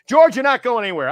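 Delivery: high, loud retold insistence; insistent call. Host keeping newsman from leaving, insistent.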